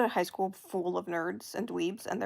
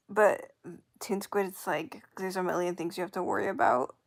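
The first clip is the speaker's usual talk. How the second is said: normal talking voice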